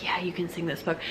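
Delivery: soft, hushed confirmation; secretive whisper. Retold or imitated. Teacher confirms the secret to the class, whispering.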